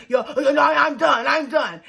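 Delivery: loud, high fed-up yell of quitting; retold shout. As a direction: shout it like you've had enough and walk away. Clint shouting he is done.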